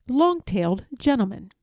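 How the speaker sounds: plain reading voice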